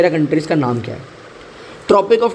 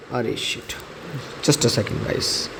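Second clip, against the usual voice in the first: muttered aside; 5 semitones lower, 9 dB quieter, slower than usual. Real, not acted. Teacher interrupted, mutters off-mic.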